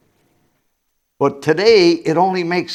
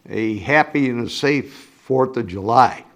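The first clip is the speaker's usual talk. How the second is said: ordinary talk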